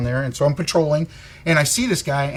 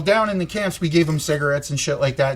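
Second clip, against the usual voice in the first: usual speaking voice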